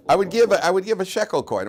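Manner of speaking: high, loud insistence; emphatic declaration. Real, not acted. Rabbi answers his own question to the class, loud and high. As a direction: proclaim it like it's obvious.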